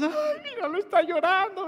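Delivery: put-on high, pitying coo over a crying child; mock worried mother cooing; a hint of anxious parent fussing; fretting parent. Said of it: "ahí estábamos".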